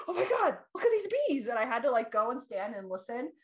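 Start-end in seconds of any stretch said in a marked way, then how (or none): 0.0-1.5 telling how she spotted the bees, thrilled and high; thrilled gasp. Retold or imitated. Seeing bees in a park.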